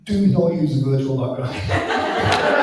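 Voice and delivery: laughing mock warning. Quoting the advice with a laugh.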